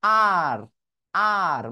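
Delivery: আর with every mouth muscle moving; Bengali rolled r. Shown as a demonstration. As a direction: exaggerate the Bengali আর. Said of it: "আমরা বাঙালিরা কিভাবে উচ্চারণ করি". (বাঙালি ঢঙে জোরে আর)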